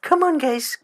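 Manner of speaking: put-on high Irish accent calling out; coaxing in a high Irish lilt. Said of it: "like, an Irish accent".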